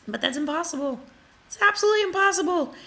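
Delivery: high and fast, insisting; rising exasperated insistence; emphatic disbelief. Real, not acted. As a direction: push it up high, absolutely certain.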